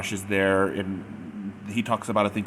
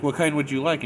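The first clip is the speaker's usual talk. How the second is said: raised, higher voice for poor hearing; imitating a louder second try; louder repeat; 7 semitones higher, 6 dB louder than usual. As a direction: repeat it louder to someone who can't hear. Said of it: "each time she's getting louder".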